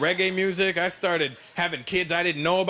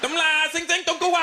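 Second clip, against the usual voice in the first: mock Chinese gibberish; 10 semitones higher than usual. Mock Chinese phone call to wife.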